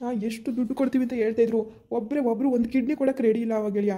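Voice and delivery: ordinary talk, nothing marked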